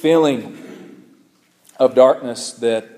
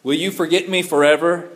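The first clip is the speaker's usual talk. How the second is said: scripture reading; 4 semitones higher than usual. Acted. Pastor reading the psalm to the congregation, solemn and pleading. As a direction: read the psalm like a heartfelt prayer.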